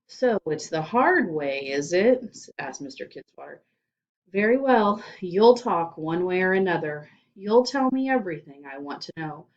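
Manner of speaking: loud menacing demand; menacing principal. Acted. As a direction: lean in like a movie interrogator. Principal's threat.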